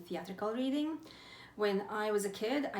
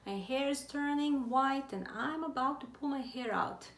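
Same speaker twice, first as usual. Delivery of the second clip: exasperated friend; 6 semitones higher than usual. Retold or imitated. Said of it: "she said".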